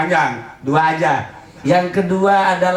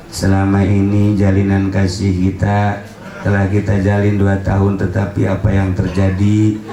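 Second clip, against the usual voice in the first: reading breakup text; 10 semitones lower than usual. Acted. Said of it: "pas baca S M S".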